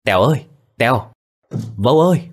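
hailing a friend from outside the house; calling out. A narrator's reading. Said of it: "có tiếng gọi vang lên".

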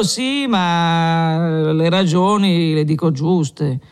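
put-on a drunk lecturing the tavern, pleased with himself; drunk Renzo.

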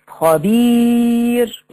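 خبير with the ee held very long and level; drawn-out long vowel. Shown as a demonstration. Showing the ya madd to students, vowel lengthened. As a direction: stretch the ya in خبير on one note. Example word with prolonged madd.

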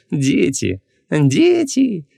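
drawn-out lament, second word soaring; mock-indulgent parent. A narrator's reading. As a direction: excuse a child's mischief theatrically. Shopkeeper feigns indulgent sigh over son.

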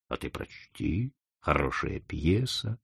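a softly didactic chief; instructive. Acted. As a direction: advise him calmly and slowly. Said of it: "наставительно сказал дедушкин".

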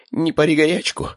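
high, placating cry; anxious plea. A narrator's reading. Uncle grabbed by angry brother, anxious plea. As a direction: beg him to calm down, pitch leaping up.

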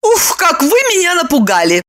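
high-pitched startled cry; startled exclamation. A narrator's reading. Lipochka reacts to Roman's howling, startled. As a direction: exclaim after a fright. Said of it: "воскликнула Липочка".

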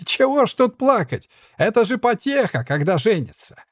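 very high, jeering puppet; jester voice. A narrator's reading. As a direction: tease the lovesick one.

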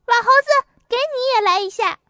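high, loud taunting yell; cheeky shout. A narrator's reading. Boy taunting the old monkey, high cheeky yell. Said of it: "还说".

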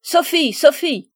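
high, loud angry call; furious shouting. A narrator's reading. Stepmother calls Sophie in from play, shouting furiously. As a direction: yell the child's name in fury. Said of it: "criait ... la voix furieuse de madame Ficini".

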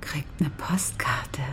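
slow, teasing whisper; sly whisper. Acted. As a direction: toss it off in a slow conspiratorial whisper. Dismissive seductive joke about Paul.

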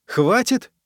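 high rising disbelieving question; incredulous echo. Acted. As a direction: throw the word back at him. Echoes the emperor in disbelief.

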